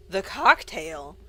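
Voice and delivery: loud, slow disbelieving bark; incredulous shout. Acted. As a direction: throw it back in disbelief. Shocked loud repeat.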